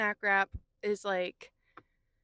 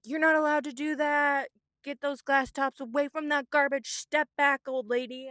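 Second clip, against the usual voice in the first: imitated angry order, raised and louder; mock bossy telling-off, pitched up; aggressive scolding; 6 semitones higher, 4 dB louder than usual. Imitating donation worker ordering her from trash can, aggressive. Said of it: "actually aggressively ran at me".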